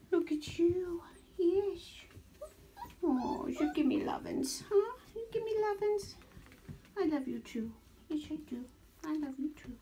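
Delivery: high, soft cooing voice. Real, not acted. Baby talk to puppies.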